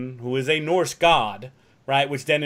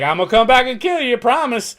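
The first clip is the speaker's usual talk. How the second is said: mock threat; 10 semitones higher, 7 dB louder than usual. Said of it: "he's like".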